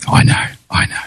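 unvoiced little whisper. Real, not acted. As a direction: breathe it as an aside. Whispered aside.

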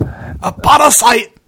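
drawn-out Scottish "A parasite!"; rolling Scottish brogue, slow and high; Scottish accent. Shown as a demonstration. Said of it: "my favourite pronunciation of the word parasite is with a Scottish accent".

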